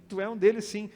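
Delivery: imitating an insistent accuser; accusing woman. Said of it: "uma das mulheres disse assim".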